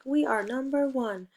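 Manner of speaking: a team cheering victory; number one cheer. Shown as a demonstration. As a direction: cheer it like a champion. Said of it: "It's like".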